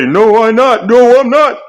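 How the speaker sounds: high, loud whiny denial; mock rival's shrill no. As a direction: squeal it like a sore loser. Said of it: "And he'll say".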